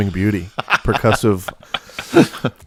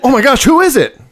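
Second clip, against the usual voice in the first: much higher and louder, a squealing mock surprise; mock gasping excitement; 8 dB louder than usual. Guest echoing the host's big reveal, in mock squealing surprise. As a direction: play along with a hammed-up gasp.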